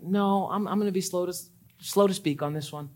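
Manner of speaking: soft, measured self-control; hushed steady patience; calm restraint. Pretend. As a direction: talk yourself down quietly. Voicing a calm restrained reply.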